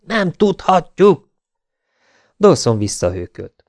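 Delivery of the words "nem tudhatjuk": high, slow, drawn-out yell; shouting. A narrator's reading. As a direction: shout it word by word in denial. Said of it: "ordította Wyatt". (elnyújtva ordítva, magas hangon)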